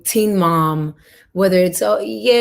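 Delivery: usual speaking voice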